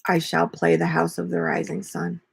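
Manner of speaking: ordinary delivery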